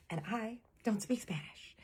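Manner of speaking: hushed, whispered confession; whispered aside. Real, not acted. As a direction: whisper it like an awkward admission. Awkward confession aside in the story.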